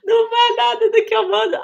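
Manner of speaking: high, loud laughing wail; half-crying through laughter; laughing complaint. Real, not acted. Mother laughing about her daughter who never obeys.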